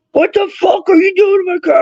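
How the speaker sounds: high, shouted accusation; retold furious yell. Angry car owner yelling at the teen by his car. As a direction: yell at the thief in a strained high voice.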